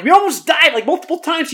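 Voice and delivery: high frantic panic; flipping out. A narrator's reading. Tosh shaking the host by the collar, high panic. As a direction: yell it like she can't believe it. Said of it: "Tosh starts flipping out".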